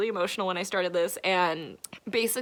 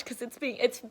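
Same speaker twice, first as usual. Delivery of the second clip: ordinary talk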